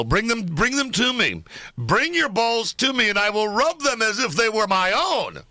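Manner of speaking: high, slow mock menace; mock menacing. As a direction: ham up a creepy threat. Mock-seductive rant to caller.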